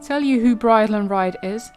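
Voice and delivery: ordinary talk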